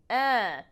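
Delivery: eh sent through the nose; nasalized vowel. Shown as a demonstration. Teacher demonstrating nasalization, eh through the nose. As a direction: make the eh nasal. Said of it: "it kind of goes to your nose".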